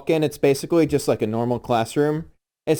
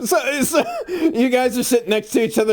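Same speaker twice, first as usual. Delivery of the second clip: laughing; 9 semitones higher than usual. Real, not acted. Laughing while narrating.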